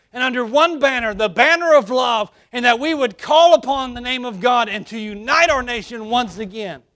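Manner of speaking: rally the church to unite the nation; preaching at full force. Acted.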